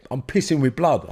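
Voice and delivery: usual speaking voice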